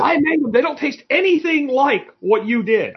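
very high whiny complaint; hammed-up baffled Brit; mock indignant friend. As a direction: play the friend, high and aggrieved.

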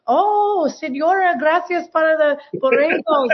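high Spanish-accented thanks, mimicking; grateful neighbor. Neighbor in the grocery store thanking her, high Spanish-accented gratitude. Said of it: "they'd be like".

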